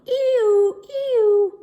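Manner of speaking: high, loud wailing siren noise; siren imitation. A narrator's reading. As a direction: wail it like a fire engine siren. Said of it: "fire engine came down the street going".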